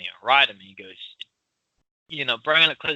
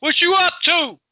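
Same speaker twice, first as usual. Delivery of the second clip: screaming across the street; 13 semitones higher than usual. Acted. Showing friend how Philly sounds, screaming across the street. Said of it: "they're screaming across the street".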